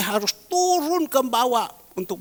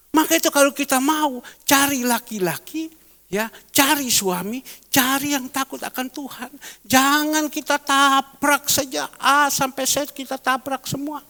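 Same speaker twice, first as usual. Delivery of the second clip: raised, high exhorting voice; loud pulpit appeal; preaching; 6 semitones higher, 3 dB louder than usual. Acted. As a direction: urge the congregation loudly.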